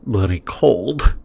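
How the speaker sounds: slow, lightly voiced dwarf; put-on dwarf NPC voice. Gamer voicing a dwarf quest giver, slow and lightly acted. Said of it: "he probably doesn't sound like that".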